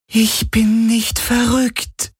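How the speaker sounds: very high, drawn-out wail of protest; plaintive lament. Acted. Shadow being protests to Galen, lamenting. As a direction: lament slowly in a high, wounded voice. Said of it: "klagte die Schattenhelix".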